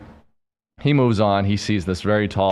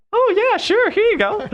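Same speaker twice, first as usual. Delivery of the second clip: very high falsetto, louder, sing-out agreement; bartender lady; 5 dB louder than usual. Retold or imitated. Bartender happily handing over her number, mock falsetto. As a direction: put on a squeaky mock female voice. Voices the bartender saying yes.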